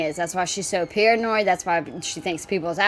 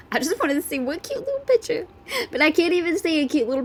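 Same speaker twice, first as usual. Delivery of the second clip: much higher, put-on whiny voice; whiny complaint; 8 semitones higher than usual. Real, not acted. Reacting to a video, whining she only wanted a cute picture.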